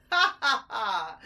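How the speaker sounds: loud high mocking laugh. A narrator's reading. Big rat mocking the mice, loud laugh. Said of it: "laughed the big rat".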